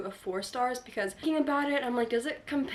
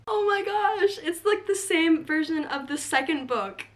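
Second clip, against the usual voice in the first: squealing with delight, high and loud; excited; 6 semitones higher, 7 dB louder than usual. Real, not acted. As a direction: gush over a thrilling find. Finds matching book in bookstore.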